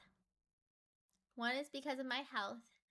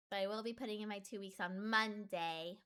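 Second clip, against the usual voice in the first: ordinary talk, nothing marked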